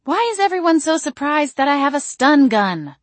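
high, loud exasperated outburst. A narrator's reading. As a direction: throw it out in exasperation. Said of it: "I threw my hands up, exasperated".

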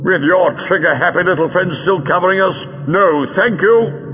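high, projected refusal; calling back. Acted. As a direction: call the refusal across the room, slowing at the end. Doctor calls back across room.

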